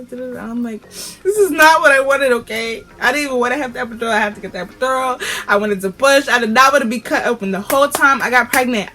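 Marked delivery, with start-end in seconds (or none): 0.9-7.5 upset retort. Retold or imitated. Retold outburst to mom and sister.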